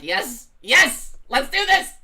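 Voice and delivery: excited cheer. Retold or imitated. Imagining New York branching off, cheering excitedly. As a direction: cheer like you'd sign up on the spot. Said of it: "I'd be like".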